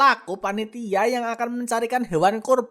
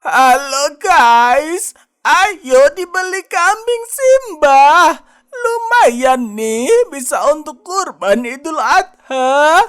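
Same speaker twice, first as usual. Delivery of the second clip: online seller hype; 3 semitones higher, 7 dB louder, slower than usual. Acted. Online seller promo video.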